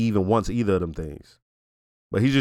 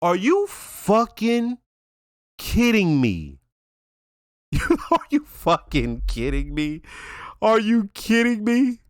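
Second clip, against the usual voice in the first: slow, drawn-out incredulity; exasperated reenactment with a laugh breaking in; mock disbelief; 8 semitones higher, slower than usual. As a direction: stretch each word in amused outrage. I lost my shit.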